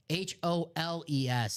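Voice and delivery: "H O L E S" spelled slowly, each letter separate. Shown as a demonstration.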